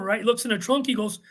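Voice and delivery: ordinary delivery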